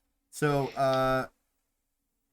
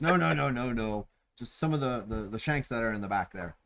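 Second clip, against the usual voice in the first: ordinary delivery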